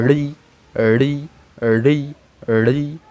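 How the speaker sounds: রি with the tongue curled back to the roof of the mouth; each রি drawn out as a separate demo; careful retroflex ri. Shown as a demonstration. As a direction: say রি slowly, tongue tip flipped up behind the palate. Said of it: "সেটা উচ্চারিত হবে এইভাবে".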